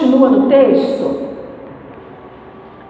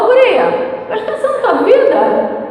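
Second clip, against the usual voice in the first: high, raised scolding voice; 10 semitones higher than usual. Retold or imitated. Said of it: "ia levantar a voz e falar". (repreensão em voz alta e aguda)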